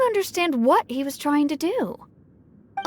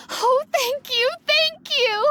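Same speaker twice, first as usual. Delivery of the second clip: grateful; 9 semitones higher than usual. Acted. Grateful parent thanks rescuer.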